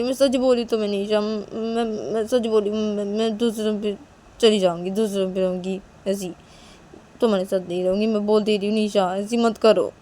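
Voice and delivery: loud, insistent acted warning; upset threat. Threatening her friend she'll move rooms, upset warning.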